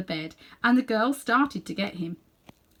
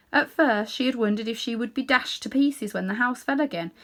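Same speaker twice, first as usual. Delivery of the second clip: ordinary delivery, nothing marked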